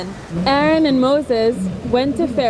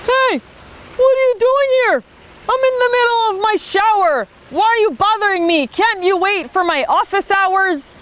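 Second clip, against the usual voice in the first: annoyed Pharaoh; 8 semitones higher than usual. Acted. Pharaoh interrupted in his shower, high and annoyed. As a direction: snap like a bothered king.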